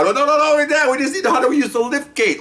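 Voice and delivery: high, urgent stop; frantic protest. Retold or imitated. Cop begging the fireman to stop sawing, high and urgent. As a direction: cut them off before the saw goes in. Quoting panic over the saw.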